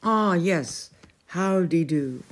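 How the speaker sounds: put-on fussy old king voice; a hint of a flustered old knight; bumbling king. A narrator's reading. The king greets Merlin in a bumbling voice.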